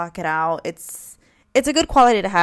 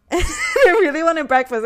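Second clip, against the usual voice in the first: laughing repeat; 8 semitones higher, 5 dB louder than usual. Real, not acted.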